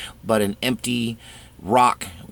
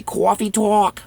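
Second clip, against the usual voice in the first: high, loud New York "cawfee tawk"; New York accent; 11 semitones higher, 4 dB louder, slower than usual. Acted. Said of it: "Italian New York accent".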